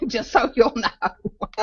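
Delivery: laughing. Real, not acted. Laughing at her own disclaimer.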